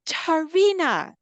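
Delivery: high, loud, drawn-out name call; coach's way of talking. Retold or imitated. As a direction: imitate her calling out the name slowly. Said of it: "this is how she talks".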